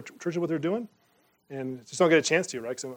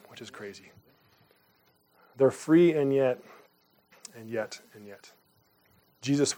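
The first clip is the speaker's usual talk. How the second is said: spoken prayer. Acted. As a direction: pray it from the heart, gently. Pastor leads closing prayer.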